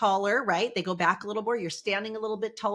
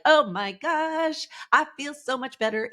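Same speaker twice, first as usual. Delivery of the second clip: slow, much higher gush of relief; relieved delight; 6 semitones higher than usual. Retold or imitated. Imitating someone five minutes into a walk, delighted. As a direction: savour how good it feels. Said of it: "you're like".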